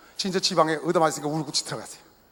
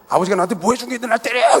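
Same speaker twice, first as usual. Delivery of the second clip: put-on teen's angry yell; 5 semitones higher, 7 dB louder than usual. Teen son yelling at father.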